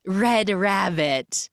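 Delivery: mysterious and slow; slow cryptic whisper-voice. Retold or imitated. Maid hushing Naomi with the code word, slow and eerie. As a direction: drag out "Red Rabbit" slowly and eerily. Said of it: "she goes".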